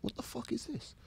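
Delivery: very quiet, high-pitched startled whisper; hushed disbelief. Retold or imitated. Man spots fingers under the cubicle, hushed alarm. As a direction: recoil under your breath, barely audible.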